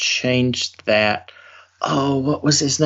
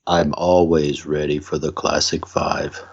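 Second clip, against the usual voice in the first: much lower, put-on game show announcer voice; deep announcer voice; 8 semitones lower than usual. Co-host answering the game-show challenge in a deep announcer voice. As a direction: boom it out like a host introducing the show. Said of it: "got a voice change and everything".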